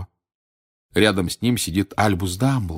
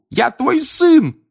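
slow, high, loud pleading shout; desperate cry; 7 dB louder, much slower than usual. A narrator's reading. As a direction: beg your father to see you. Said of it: "крикнул он".

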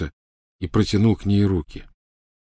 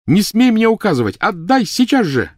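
put-on furious girl; 14 semitones higher, 6 dB louder than usual. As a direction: spit each word out with a blow. Hermione yelling.

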